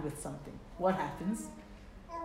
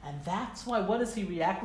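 normal talking voice

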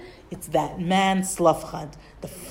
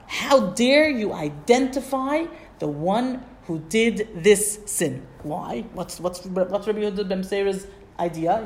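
ordinary delivery

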